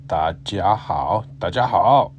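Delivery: 大家好 said slowly and carefully. Shown as a demonstration. Teacher modelling 大家好 slowly for students. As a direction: stretch out 大家好 for learners.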